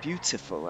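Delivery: "Beautiful" carefully, with a true T. Shown as a demonstration. Player proving correct elocution, T sounded in "Beautiful". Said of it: "I'm saying it correctly now".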